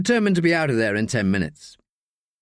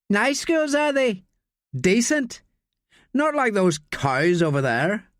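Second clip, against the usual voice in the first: put-on sneering drunk student; 10 semitones higher, slower than usual. Drunk student pressing Daniel to fetch beers, high jeering voice. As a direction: lean in and goad him like a drunk bully. Green leering about girls.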